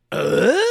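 short rising grunt; loud questioning grunt; American grunt. Shown as a demonstration. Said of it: "basic old grunt is".

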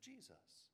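"Jesus," lifted high and soft, set apart; soft, high "Jesus," naming who is meant; named with emphasis. Shown as a demonstration. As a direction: float "Jesus," up as a clear answer to who "he" is. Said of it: "Matthew will say".

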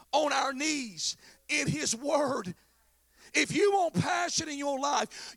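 loud, driving sermon delivery; full-force pulpit preaching; impassioned preaching. Real, not acted. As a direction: preach it hard, punching the key words.

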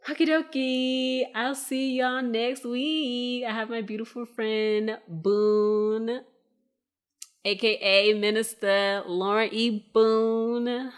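high, loud, stretched-out announcing; big, slow, celebratory guest shout-out; sing-song sign-off. Real, not acted. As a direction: draw out the last words in a playful singsong.

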